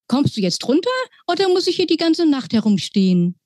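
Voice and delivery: high, fast impatient mouse pilot. Acted. Mouse pilot impatiently urges the girl to come down fast. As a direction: hurry her along, squeaky and quick. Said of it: "fragte ungeduldig".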